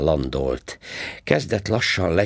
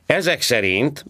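sarcastic policeman; 10 semitones higher than usual. A narrator's reading. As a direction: needle him like a smug traffic cop. Policeman speaking. (gúnyos rendőrhang, magasan és hangosan)

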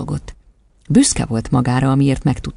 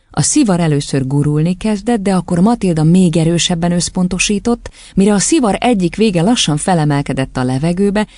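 ordinary delivery, nothing marked

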